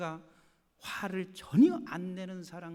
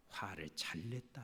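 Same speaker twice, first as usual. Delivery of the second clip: whisper; 14 semitones lower, 18 dB quieter than usual. Retold or imitated. Imagined self-approval, hushed.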